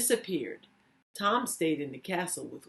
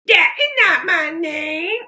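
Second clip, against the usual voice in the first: goblin voice; 11 semitones higher, 12 dB louder than usual. Acted. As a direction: bellow it like a gleeful goblin. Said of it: "smiled the goblin".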